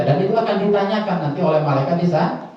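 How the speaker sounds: ordinary delivery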